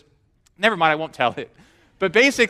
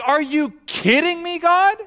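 high-pitched, incredulous imitation of Abraham; mock disbelief; 8 semitones higher than usual. Abraham answering God's promise, mock disbelief. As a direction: ask it as if you can't believe your ears. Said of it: "falls on the ground in unbelief".

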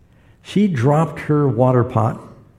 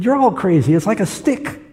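blind man; 8 semitones higher than usual. Retold or imitated.

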